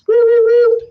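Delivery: high, loud siren wail; alarm siren. Retold or imitated. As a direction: howl it like an alarm going off. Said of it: "He's sounding the alarms".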